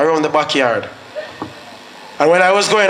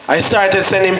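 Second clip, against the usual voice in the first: ordinary talk, nothing marked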